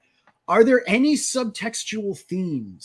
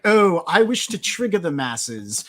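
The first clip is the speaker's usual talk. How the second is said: higher, mock British pretentious artist; British accent; 6 semitones higher than usual. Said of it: "why I gave him a British accent".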